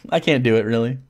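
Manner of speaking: ordinary delivery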